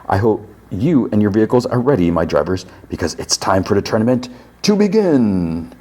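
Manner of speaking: imitated tournament host, pitch lifted; Calypso. Calypso sends his drivers off, showman's announcement.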